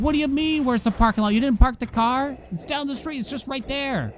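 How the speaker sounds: high, indignant mock rant; imitating angry boss. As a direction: play the irritated valet boss scolding. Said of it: "He got very mad".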